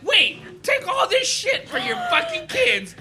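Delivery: very high, slow, laughing yell; mock pleading hosts. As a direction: beg her through laughter, way up high. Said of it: "And they're like".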